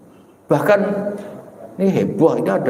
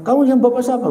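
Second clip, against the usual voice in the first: higher, quick concerned asking; worried sibling's question; 9 semitones higher, faster than usual. Retold or imitated. Kakak-kakak menanyai adiknya, nada cemas lebih tinggi. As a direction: show the siblings' alarm.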